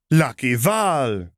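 high, loud, slow drawn-out nickname; beaming return greeting. Acted. Zariah hugging Valmora back, beaming. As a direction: call her name out with delight. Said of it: "Zariah returned".

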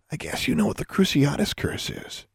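soft, whispery threat; menacing hush. A narrator's reading. Bully hints at a torture curse, quietly menacing. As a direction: drop to a sinister near-whisper.

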